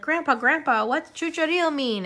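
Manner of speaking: put-on curious little kid. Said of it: "And I remember being like".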